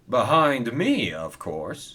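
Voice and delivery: slow, drawn-out haughty put-down; a touch of snooty superiority. A narrator's reading. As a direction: look down your nose at them. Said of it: "said Spencer snootily".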